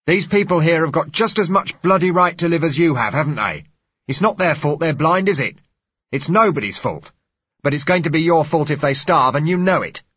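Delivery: loud, high, furious harangue; angry tirade. Acted. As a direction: rage at the man behind the gates. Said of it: "he said angrily".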